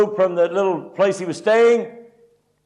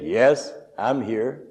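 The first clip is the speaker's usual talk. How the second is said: voice from above; 9 semitones lower, 5 dB quieter, much slower than usual. Retold or imitated. God answers the man hanging on the cliff, calmly and low. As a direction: imitate God replying, low and unhurried. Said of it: "And a voice came back".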